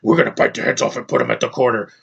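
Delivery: whispered, rasping movie-monster growl; mock monster voice. Reviewer imitating the symbiote threatening heads, mock monster rasp. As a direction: growl it low in the throat, without full voice. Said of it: "he's talking like this".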